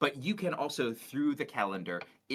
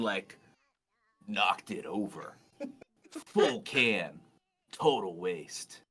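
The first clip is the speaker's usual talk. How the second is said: slow, laid-back surfer dude; lightly voiced chill surfer guy, unhurried; [name] surfer voice; 3 semitones lower, slower than usual. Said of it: "read these again in your, in your surfer, surfer voice here".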